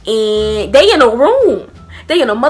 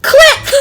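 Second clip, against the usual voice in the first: phone click sound; 5 dB louder than usual. Retold or imitated. Hanging up the phone.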